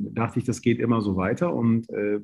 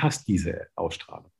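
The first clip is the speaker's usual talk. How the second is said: hushed compliment; 5 semitones lower, 6 dB quieter than usual. Retold or imitated. Recruiter lowers voice flatteringly.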